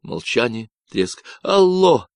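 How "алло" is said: loud, high shout into the radio. Acted. Michael shouting into the silent walkie-talkie, loud. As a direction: call out to make him answer. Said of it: "крикнул Майкл".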